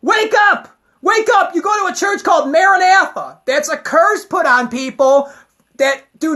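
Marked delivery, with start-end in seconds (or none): 0.0-3.4 yelling at grandma. Pretend. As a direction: shout it right in her face, fast at the end. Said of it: "slap her and be like".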